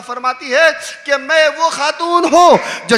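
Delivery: loud, high preacher's call; loud impassioned majlis preaching; preaching at full force. Acted. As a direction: cry it out to the gathering.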